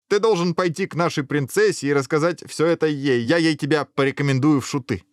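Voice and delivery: high-pitched, bubbling, eager urging; amused enthusiasm. Acted. Guard recommending the prince as jester, amused enthusiasm.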